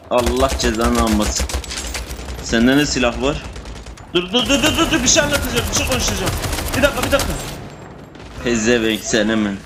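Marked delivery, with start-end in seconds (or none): 4.1-7.3 much higher, rapid pleading "dur, dur"; urgent high-pitched calls to hold on; frantic stop. Real, not acted. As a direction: beg them to wait, voice jumping up. Frantically stopping teammates mid-fight.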